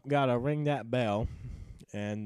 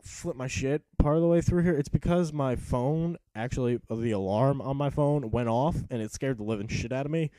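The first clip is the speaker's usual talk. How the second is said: usual speaking voice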